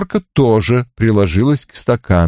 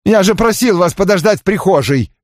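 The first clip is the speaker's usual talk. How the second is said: raised angry growl; 11 semitones higher than usual. A narrator's reading. Barrett snarling at the detective in the hallway. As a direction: snarl it at the intruder. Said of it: "зарычал на меня Барретт".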